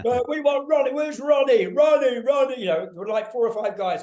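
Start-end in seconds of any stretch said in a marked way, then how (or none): 0.0-2.6 high, loud imitation of a chanting crowd; hecklers calling out. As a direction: shout it like fans demanding their singer. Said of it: "calling out to me".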